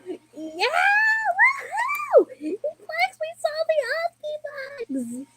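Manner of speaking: very high, stretched cartoon cheering; Tootie. A streamer showing off an impression, high-pitched Tootie. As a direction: squeak it out high and drawn-out. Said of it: "I can do a bit of Tootie".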